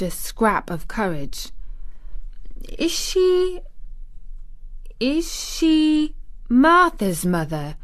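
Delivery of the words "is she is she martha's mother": put-on high, slow, hesitant child; stammering. A narrator's reading. Mary daring to ask her guardian, stammering. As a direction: falter and restart the question timidly. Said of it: "she stammered".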